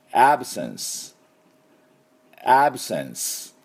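"absence" said clearly twice, open ae then sence; crisp teacher model of "absence", ending on s; repeat after me. Shown as a demonstration. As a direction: say "absence" for students to echo. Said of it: "私の後について発音".

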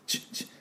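quiet sizzle-like ch clicks; hissing tch sounds; voiceless sizzle ch. Shown as a demonstration. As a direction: spit out short voiceless ch sounds like grill sizzle. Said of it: "鉄板に落ちた時のチッ".

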